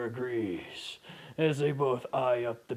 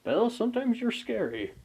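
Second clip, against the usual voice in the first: teasing companion voice; 9 semitones higher than usual. Acted.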